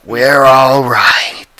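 slow eerie reassurance; slow eerie possessed reassurance; creepy calm. Retold or imitated. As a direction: drawl it out, something wrong inside. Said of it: "something like that".

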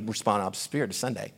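low, hushed inner nudge; quiet prompting. Retold or imitated. The Lord tapping on him after prayer, quiet prompting. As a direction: murmur it as a soft, insistent prompt.

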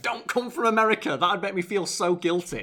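high, loud pleading outburst; exasperated mock plea; dismayed protest. Acting out his reaction to fans.

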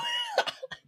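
high-pitched squealing laugh. Real, not acted. Laughing at roommate story.

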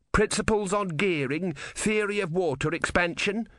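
understated shout, each word drawn out. A narrator's reading. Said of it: "shouted Ern".

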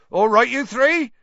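loud, high-pitched yell over a crowd; Hagrid. A narrator's reading. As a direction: holler a hearty greeting across a distance. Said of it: "Hagrid yelled over the heads of the crowd".

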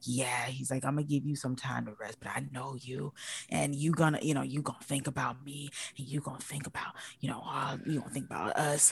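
low, half-whispered imitation of a manipulator; hushed, insinuating mock-seduction; creepy, manipulative. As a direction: murmur it very softly and creepily. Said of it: "that's when it got creepy".

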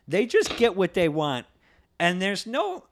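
rising rant. Real, not acted. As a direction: hammer it home, getting worked up.

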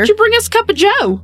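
high, quick put-on voice; mock chirpy detective. Detective asking suspect for coffee, mock chirpy.

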